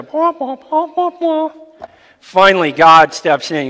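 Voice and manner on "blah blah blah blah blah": high nonsense blah-blah chatter; mock the endless empty talk; mocking blabbering friends. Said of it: "their friends are".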